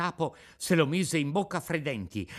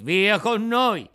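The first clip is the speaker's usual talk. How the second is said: slow, loud order; firm command; 8 semitones higher, 10 dB louder, much slower than usual. A narrator's reading. The carabinieri cut off Pinocchio's protest, loud. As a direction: cut him off flatly.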